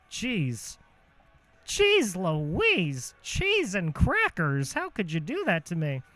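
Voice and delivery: slow, drawn-out mock dismay; goofy exasperation. Comedian whining at his own audience in a goofy voice. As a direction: stretch it like a cartoon dad.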